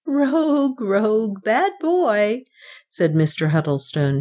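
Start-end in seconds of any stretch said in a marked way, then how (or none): 0.1-2.4 high, playful finger-wagging scold; scolding banker. A narrator's reading. Banker playfully scolds Northmour, high and wagging. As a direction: scold him like a naughty child. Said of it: "shaking his finger".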